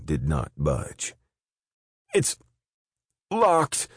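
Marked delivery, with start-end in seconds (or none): 2.1-3.9 high, loud panicked wail. Acted. Guard wailing at the locked door. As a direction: cry out in despair at the door. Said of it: "Daggett wailed".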